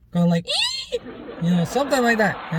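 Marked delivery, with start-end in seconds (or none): 0.5-1.0 very high, thin squeal, kept soft; soft, piercingly high mock shriek; imitated girlish scream. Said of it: "me screaming like that".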